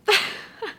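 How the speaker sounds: high, breathless overwhelmed giggle; a giddy laugh bursting out. Real, not acted. Overwhelmed reaction to finished book.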